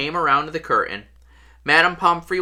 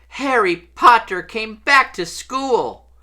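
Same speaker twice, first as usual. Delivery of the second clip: high, slow, woeful elf voice; miserable house elf; 8 semitones higher, 3 dB louder, slower than usual. A narrator's reading. House elf to Harry at his bedside, miserable high voice. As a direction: lament it in a high elfin voice. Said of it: "he whispered miserably".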